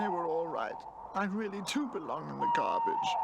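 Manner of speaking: high, tearful little voice; lost toy's self-pity; despairing. A narrator's reading. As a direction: give up hope out loud. Pino despairing alone.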